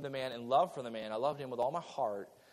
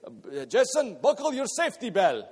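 put-on higher, louder broken Russian-English accent; grandfather's broken English; 5 semitones higher, 7 dB louder than usual. Preacher imitating his Russian grandfather's broken English, higher. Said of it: "He talked broke English".

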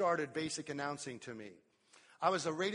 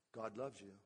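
low and soft, gently reassuring; soft reassurance; 6 semitones lower, 8 dB quieter than usual. Retold or imitated. Pastor answering a troubled member, soft and reassuring. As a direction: tell them softly God loves them.

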